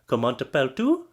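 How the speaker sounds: French phrasebook dialogue with lifted questions; schoolroom French. Shown as a demonstration. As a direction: recite beginner French like a class exercise. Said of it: "I can say some stuff in".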